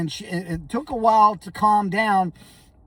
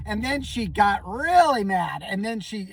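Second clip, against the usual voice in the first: ordinary talk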